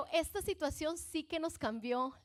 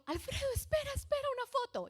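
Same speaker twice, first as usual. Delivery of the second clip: much higher, pleading cry to wait; frantic plea; 12 semitones higher than usual. Retold or imitated. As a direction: stop him for one photo. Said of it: "Y entonces yo".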